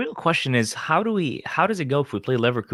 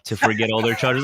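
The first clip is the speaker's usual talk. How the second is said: ordinary talk, nothing marked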